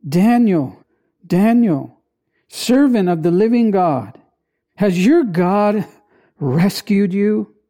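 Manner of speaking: ordinary delivery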